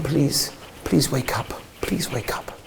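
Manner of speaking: put-on soft, slow, breaking plea to a sleeping child; pleading whisper. Parent at son's hospital bed, whispered begging. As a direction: barely breathe the words, begging him to wake.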